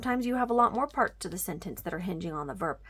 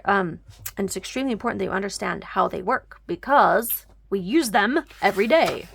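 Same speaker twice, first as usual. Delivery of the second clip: usual speaking voice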